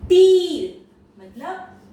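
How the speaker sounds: तीर stretched slowly, high and loud; long high ee. Shown as a demonstration. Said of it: "ऊंची रखनी है". (तीर को लंबा, ऊंचा खींचें)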